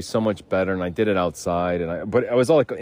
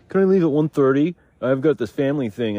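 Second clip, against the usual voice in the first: pitched up, asking sweetly for leave; high pleading request; 7 semitones higher, 4 dB louder than usual. Retold or imitated. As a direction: put on a polite, nervous ask. Asking boss for time off.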